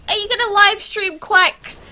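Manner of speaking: high-pitched mock pleading question; pestering fans. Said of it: "everyone's going".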